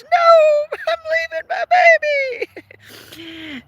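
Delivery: high mock cry, rising then fading; hammed-up tearful wail at leaving. Owner acting out her tearful goodbye to her dog with a mock wail. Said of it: "I just about break out into tears".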